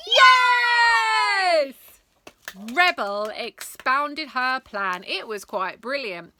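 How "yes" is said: crowd shout. Acted.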